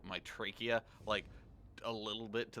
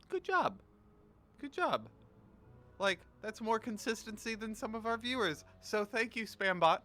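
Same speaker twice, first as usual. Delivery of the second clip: very high, silly praise voice; squeaky high voice; 10 semitones higher, 3 dB louder than usual. Pretend. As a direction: squeak it out like a cartoon. Squeaky praise for spam bot.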